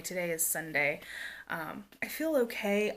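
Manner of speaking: ordinary talk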